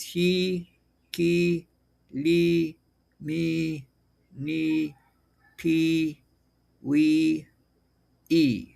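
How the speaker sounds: "he, ki, li, mi, ni, pi, wi, e" with long "ee" vowels; syllable demonstration. Shown as a demonstration. Teacher showing the vowel I, drawn-out separate syllables. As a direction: hold each syllable of "ki, li, mi" level and separate. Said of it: "would be pronounced as".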